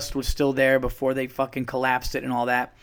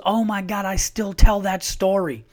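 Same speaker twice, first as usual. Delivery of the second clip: higher retold excitement, stretching the words; excited recognition; 7 semitones higher, 5 dB louder than usual. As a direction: imitate her thrilled amazement. Retelling judge's excited reaction.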